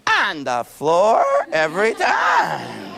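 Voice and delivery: slow, loud, laughing shout. Acted. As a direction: howl it with a laugh. Tantrum on the floor.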